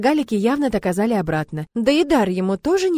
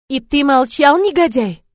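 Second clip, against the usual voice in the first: louder, higher indignant cry; outraged; 6 semitones higher, 7 dB louder, slower than usual. A narrator's reading. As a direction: scold him in mock outrage. Heroine jumps up, outraged.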